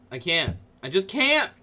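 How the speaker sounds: ordinary talk, nothing marked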